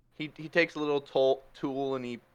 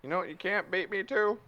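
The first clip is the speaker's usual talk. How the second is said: normal talking voice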